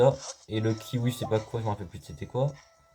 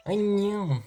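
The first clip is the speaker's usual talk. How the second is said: 1 silly creature voice; 10 semitones higher than usual. Pretend. Voicing a fleeing creature.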